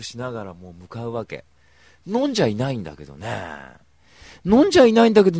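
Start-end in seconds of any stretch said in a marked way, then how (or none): 4.4-5.4 a sudden high yell on the repeat; high loud shout. Shown as a demonstration. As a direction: belt it out much higher and louder. Repeats line, second take shouted.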